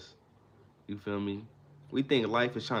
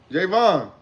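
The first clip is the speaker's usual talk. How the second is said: loud high call to someone; 6 semitones higher, 9 dB louder than usual. Real, not acted. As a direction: call his name across the room. Calling out to Javon.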